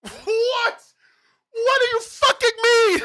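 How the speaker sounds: high-pitched, outraged screaming; angry yell. Real, not acted. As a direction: scream it in furious disbelief. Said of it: "heard me yell".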